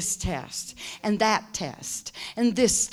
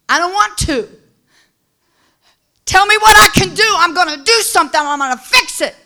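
higher, louder, hurried objecting; restless protest; 9 semitones higher, 12 dB louder, faster than usual. Acted. Believer refusing God's rest, in a high hurried protest. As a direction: fidget and argue like a restless child.

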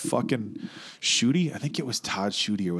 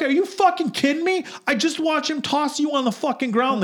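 high, louder disbelieving cry; incredulous outburst; 14 semitones higher, 3 dB louder than usual. Retold or imitated. Bouncer can't believe girlfriend defends him, high incredulous yell. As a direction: throw your voice up in disbelief.

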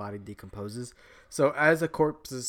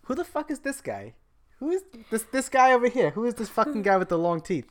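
high-pitched, indignant challenge; bouncer at Hell; 11 semitones higher, 5 dB louder, faster than usual. Acted. Bouncer at Hell challenging a newcomer, indignant. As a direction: demand to know who dares show up. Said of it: "the bouncer at Hell, and he's like".

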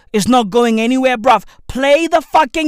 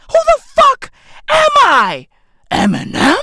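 high-pitched incredulous yell; comic shriek of bafflement; exasperated outburst; 12 semitones higher than usual. Acted. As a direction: squeal it in mock disbelief. Exasperated mock rant, repeating himself.

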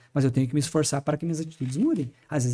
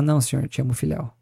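triste; 3 semitones lower than usual. Retold or imitated. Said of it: "Aí Pedro, triste, fala".

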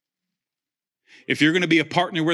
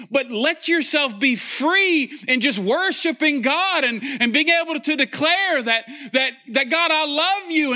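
fiery preaching; 12 semitones higher than usual. Acted. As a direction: preach it with fire, pushing the congregation. Preaching at full force.

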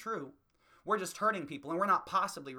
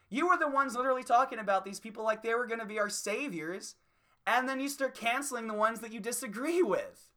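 louder, higher, yelling down at the liberals; angry rant; 6 semitones higher, 5 dB louder than usual. Real, not acted. Comic ranting at liberals in the audience, loud and angry. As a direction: tear into them, voice raised and climbing.

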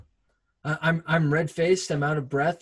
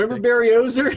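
excited call-back; 9 semitones higher, 9 dB louder, much faster than usual. Retold or imitated. Gleeful call-back to ad clip.

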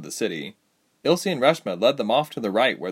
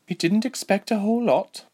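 higher-pitched, frank woman fighter; Lieselot; 12 semitones higher than usual. Acted. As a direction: answer lightly in a raised character voice. Voices Lieselot's reply.

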